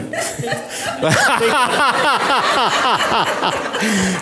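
a long, rolling real laugh. Real, not acted. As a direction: laugh hard at your own joke. Laughing at own cowboy joke.

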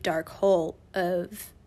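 usual speaking voice

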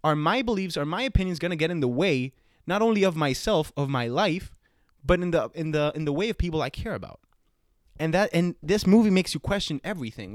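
normal talking voice

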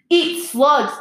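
high, loud angry retort. A narrator's reading. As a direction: burst out at the bully. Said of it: "said Ron angrily".

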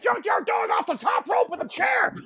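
high-pitched, excited ringside commentary; over-the-top play-by-play; mock wrestling announcer. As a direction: call it like a frantic wrestling commentator. Mock wrestling commentary on kids' fight.

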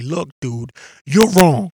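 mock blunt confrontation; blunt call-out. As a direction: bark the correction right at them. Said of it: "when you go to them and say".

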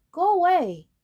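high, loud shout to go. Acted. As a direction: yell it, sending him off. Boy sends the calf off.

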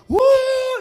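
high, loud gleeful whoop; imitating wife's whoop. Telling the church how his wife whoops while playing. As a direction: mimic her cheering as she throws the ball. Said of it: "e gritava".

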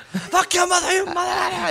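high furious Boston yell. Retold or imitated. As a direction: scream it high and hard. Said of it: "You just went off on this guy".

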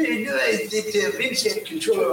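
put-on halting mumble; imitated rambling phone complaint; Stu Hart. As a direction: stammer through it in the caller's voice. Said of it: "Yeah, Stu, uh, what are you doing".

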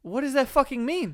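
high, loud baffled outcry; genuinely lost, throw the question out loud; baffled outburst. Real, not acted. Asking friend about the dream line, baffled outcry.

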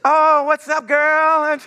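high, loud gushing greeting; mom's switched phone voice. Acted.

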